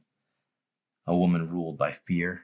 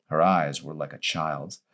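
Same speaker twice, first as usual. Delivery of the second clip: ordinary delivery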